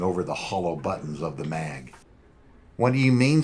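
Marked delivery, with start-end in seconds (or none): 2.8-3.4 high, unpleasant whine; whiny voice. Acted. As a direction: whine it like a sulky punk. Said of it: "in an unpleasant, whiny voice".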